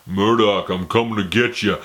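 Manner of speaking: slurred action-hero impression; growing threat in a put-on tough-guy mumble; Rambo. Hero warning his enemy, slurred mock threat. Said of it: "He doesn't talk like that".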